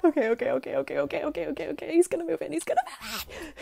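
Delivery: can barely contain the excitement; giddy excitement. Real, not acted. Cat agreeing to move in.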